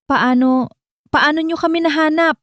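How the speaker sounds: high, strained furious demand; wounded criminal's angry outburst. A narrator's reading. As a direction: spit out the question in disbelieving rage. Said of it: "Gising na gising ang galit sa boses ni Mateo".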